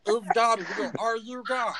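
a little gnome spiralling into a crisis; panicky gnome Stitch. Acted. Gnome Stitch freaks out that a stranger guessed his name.

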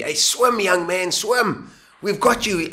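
high, loud calling from a ship; pirates shouting. Retold or imitated. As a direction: call out across the water. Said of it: "they shouted to me".

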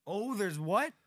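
high loud incredulous question; surprised. Real, not acted. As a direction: squeal of disbelief. Reacting to odd statement.

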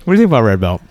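mouth full. Real, not acted. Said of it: "I'm eating a red velvet cupcake".

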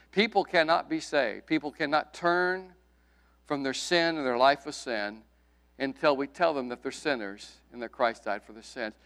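ordinary talk, nothing marked

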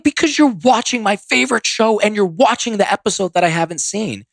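high-pitched indignant retold protest. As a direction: whine your grievance in a mock yell. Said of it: "no reason to raise your voice".

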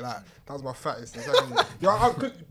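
ordinary talk, nothing marked

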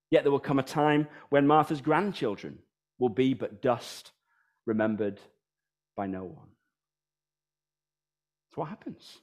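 ordinary delivery

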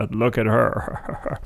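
slow, dopey cartoon-teen voice; slack-jawed dopey leer; Beavis and Butthead voice. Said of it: "like a Beavis and Butthead kind of thing".

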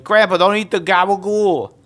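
high, loud mock New Yorker; New York accent. Grandchild warning grandpa off food, loud New York accent. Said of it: "New York accents".

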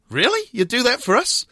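high-pitched, mock amazement; amazed reply. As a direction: ask it in high, incredulous delight. Acted amazed reply to an offer.

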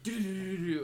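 loud, rapid engine-brake stutter; imitated truck noise. Hunter recalls a passing truck, imitated loud brake rattle. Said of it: "this big-ass truck that came by".

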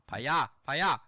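loud, shooing Spanish call; mock Spanish mom. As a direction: shoo them away loudly.

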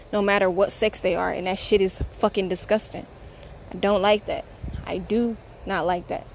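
usual speaking voice

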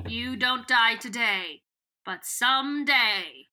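slow, deliberate mock threat, each word spaced; lightly acted menace, drawn out slowly. Marco warning the captured Yeerk, a slow mild threat.

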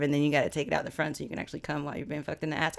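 quick, quiet rush of words; said fast. Real, not acted. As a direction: hurry through it to get it over with. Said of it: "I had to say it fast".

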